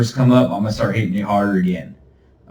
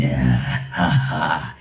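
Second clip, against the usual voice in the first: demon chuckle; 7 semitones lower than usual. Pretend. Demon laugh.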